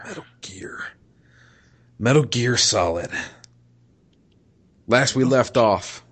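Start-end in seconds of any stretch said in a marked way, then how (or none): 0.1-0.8 hushed whisper. Real, not acted. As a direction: breathe the title. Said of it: "whispered game title".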